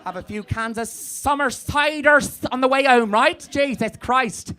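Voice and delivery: put-on high, loud West Country Somerset accent; hammed-up Somerset judge, scandalised in court; broad Somerset accent, pitched well up. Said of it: "if the judge was from Somerset".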